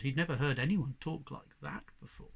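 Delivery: ordinary delivery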